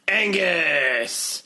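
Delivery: long drawn-out cry of a name; cat calling out the one word it knows; talking cat. Acted. Cat saying its own name, talking-cat cry. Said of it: "first thing they would say".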